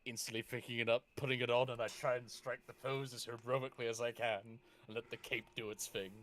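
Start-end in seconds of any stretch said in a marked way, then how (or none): none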